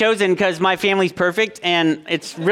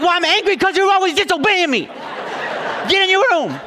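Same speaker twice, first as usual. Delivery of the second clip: mock angry dad; 13 semitones higher than usual. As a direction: ham up a cross dad sending him to his room. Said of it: "I was like".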